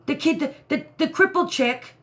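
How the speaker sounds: ordinary delivery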